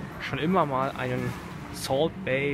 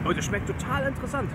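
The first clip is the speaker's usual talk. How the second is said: spricht mit vollem Mund, hoch und schnell; 9 semitones higher, faster than usual. Real, not acted. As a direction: Worte um einen Bissen herum. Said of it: "ich muss erst mal spucken". (mit vollem Mund probierend)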